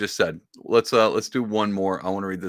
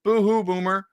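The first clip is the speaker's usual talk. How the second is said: high taunting boo-hoo voice; mock crybaby taunt; mocking young people; 9 semitones higher, 4 dB louder than usual. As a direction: jeer it like a smug kid. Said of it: "being like".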